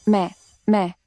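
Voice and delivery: halting repeated first word; stammering. Acted. Girl resists going to hospital, stammering. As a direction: stumble on the opening word with a pause between tries. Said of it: "छोटी हकलाने वाली".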